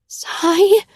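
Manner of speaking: high pained cry of his name. A narrator's reading. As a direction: cry out in pain. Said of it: "I groan".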